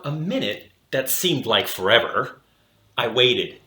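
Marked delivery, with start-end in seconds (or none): none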